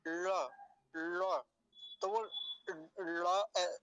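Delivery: ल said from the roof of the mouth, close to ड; tongue curled back for ल, halfway to ड; retroflex ळ sound. Shown as a demonstration. Teacher shows new learners the retroflex ळ sound. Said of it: "एक बार देख लीजिए".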